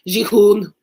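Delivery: Jihoon said in a light, put-on Arabic accent, loud and falling; mock Arabic accent on Jihoon. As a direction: say Jihoon the way an Arabic speaker would, with a firm fall. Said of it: "if I could say it in an Arabic accent".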